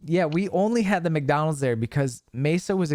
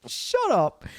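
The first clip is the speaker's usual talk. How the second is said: flustered shut up; 11 semitones higher, slower than usual. Retold or imitated. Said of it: "I'd be like".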